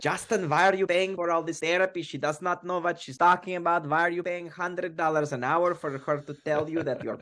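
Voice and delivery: put-on high Russian-accented mother voice. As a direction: play a scoffing Russian mom. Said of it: "in her Russian accent".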